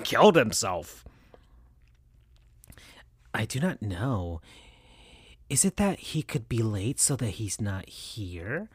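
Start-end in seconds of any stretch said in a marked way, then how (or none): 3.3-8.7 soft, subdued sad question; quietly worried, a touch sad. Acted. Rouge answers Amy about Shadow, softly sad. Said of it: "said Rouge back to Amy in a sad way".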